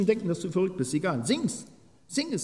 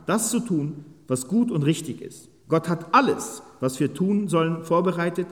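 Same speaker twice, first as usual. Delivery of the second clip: raised, earnest Bible reading; scripture reading; 6 semitones higher, 5 dB louder than usual. Acted. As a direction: read the verses out to the congregation. Reads scripture aloud. (feierliche Bibellesung)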